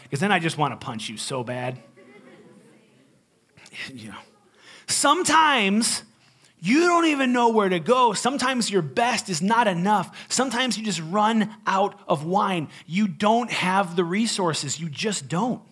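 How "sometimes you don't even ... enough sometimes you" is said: preaching. Acted. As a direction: preach it with full force. Preaching climax.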